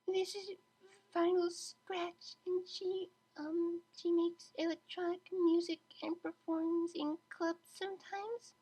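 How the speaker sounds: high, slow, hesitant voice; timid. A narrator's reading. Anxious mare introducing Vinyl to the class. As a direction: tiptoe through each word.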